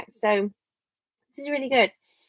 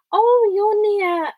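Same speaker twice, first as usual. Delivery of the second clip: surprised; 11 semitones higher, 8 dB louder, much slower than usual. Real, not acted. Spots a friend's town on map.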